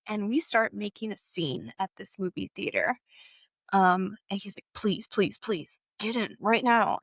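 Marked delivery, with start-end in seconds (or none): none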